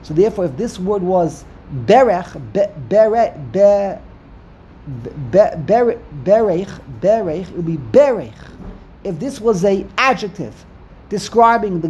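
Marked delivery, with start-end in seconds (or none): 1.8-8.3 "berech" sounded out haltingly, BE-rech; stress on first syllable. Shown as a demonstration. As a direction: punch the BE of "berech". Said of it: "emphasis is gonna be on the second to last syllable".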